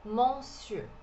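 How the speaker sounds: monsieur said the French way, as mosiu; French pronunciation. Shown as a demonstration. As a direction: monsieur with a rounded French eu at the end. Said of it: "Em francês, a gente fala". (monsieur com pronúncia francesa)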